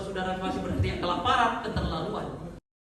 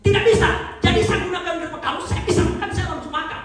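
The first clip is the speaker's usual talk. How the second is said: high, loud, driving sermon voice; full-force preaching; 9 semitones higher, 7 dB louder than usual. Acted.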